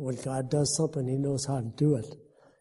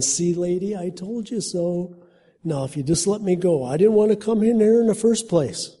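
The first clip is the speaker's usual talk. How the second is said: put-on higher, louder grumbling old man; told-you-so grumble; old Jack grumbling to the woman; 5 semitones higher, 5 dB louder than usual. A narrator's reading. Old Jack protests in cafeteria.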